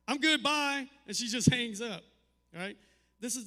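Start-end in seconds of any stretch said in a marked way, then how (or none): 0.1-0.9 loud, high breezy daughter voice; imitating a daughter dodging the question; mock quick goodbye. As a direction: cut dad off and hang up. Daughter hanging up on dad.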